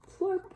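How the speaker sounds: high slurping noise; playful slurp effect; slurp sound. A narrator's reading. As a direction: slurp it like spaghetti.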